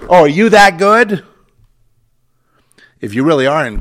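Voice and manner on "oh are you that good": high, loud mock amazement; raised-voice scornful question; sarcastic challenge. Real, not acted. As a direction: throw it at them as a loud, incredulous taunt. Sarcastic challenge to those believers.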